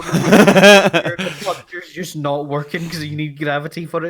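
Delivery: slow, loud, laughing complaint; lightly voiced fed-up spaceman laughing; laughing mock astronaut. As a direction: push the words out through laughter. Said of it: "They go".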